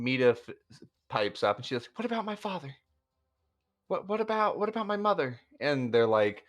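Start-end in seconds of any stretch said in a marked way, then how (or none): 1.9-5.4 high, frantic pleading; panicked young woman. Acted. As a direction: voice her rising panic about her parents. Mita panicking about her parents.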